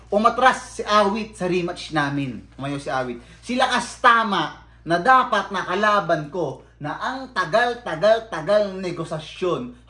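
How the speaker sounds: usual speaking voice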